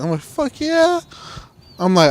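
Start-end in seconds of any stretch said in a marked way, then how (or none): 0.2-1.0 high squeal of excitement; eager yell. Retold or imitated. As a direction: jump at the offer. Retelling his eager reply.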